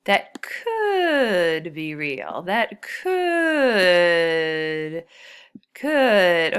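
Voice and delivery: could stretched slow and loud; stress on could. Shown as a demonstration. Teacher stressing the vocabulary word "could" for the class. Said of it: "But the word could".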